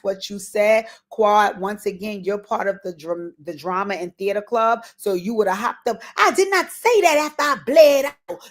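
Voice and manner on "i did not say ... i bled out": high, loud, over-dramatic protest; theatrical mock outburst. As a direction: put on a whole show of outraged denial. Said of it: "put on a whole fucking show".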